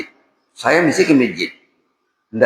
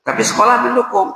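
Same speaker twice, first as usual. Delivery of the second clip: rising exhortation; 11 semitones higher than usual. Acted. Preacher rises to climax.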